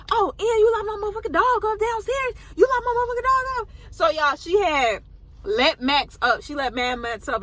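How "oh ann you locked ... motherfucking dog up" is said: high, loud mock yell of an angry mom; mom yelling at daughter. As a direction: shriek it like a furious mother. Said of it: "she was like".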